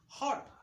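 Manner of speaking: हॉट said with a short open vowel, not a long "o". Shown as a demonstration. Teacher showing that ओ is not read as "o", short-vowel हॉट. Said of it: "इसका sound जो है कि short है".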